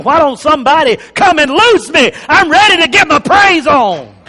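loud, high impatient cry to be freed; eager Lazarus. Retold or imitated. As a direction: Lazarus itching to praise. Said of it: "Lazarus was saying".

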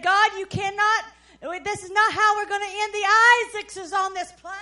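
loud, high, fast plea to God; frantic protest to God; desperate plea. Retold or imitated. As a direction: cry out to God over the storm. Pleading with God in thunderstorm.

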